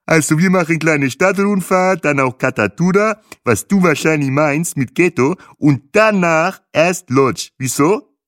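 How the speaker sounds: put-on loud, high guide with accent; African accent. Guide explains the tour to Trixie in his accent. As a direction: explain the plan patiently.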